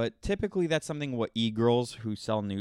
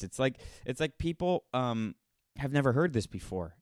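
normal talking voice

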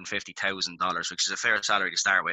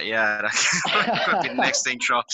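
laughing; 4 semitones higher, 4 dB louder, slower than usual. Real, not acted. Laughing at own joke.

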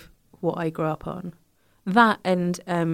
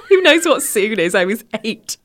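laughing high and loud; 4 semitones higher, 6 dB louder than usual. Real, not acted. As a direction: laugh through the words. Laughing at the memory.

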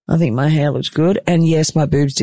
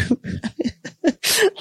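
high laugh; 5 dB quieter than usual. Real, not acted. Laughing at own anxious thoughts.

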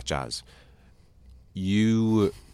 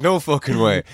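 higher, louder mock amazement; retold disbelief; 6 semitones higher, 7 dB louder than usual. Reacting to partner's Instagram discovery, louder disbelief. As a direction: blurt it out in acted disbelief.

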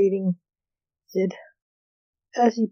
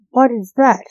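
louder, higher outraged question; indignant fury; 4 semitones higher, 7 dB louder than usual. A narrator's reading. Lance confronting the waiter over the meals, furious but contained. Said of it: "Lance asked furiously".

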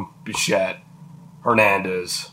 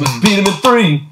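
higher and louder rallying cry; excited cheer; 7 semitones higher, 5 dB louder than usual. Real, not acted. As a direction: pump it up for the team.

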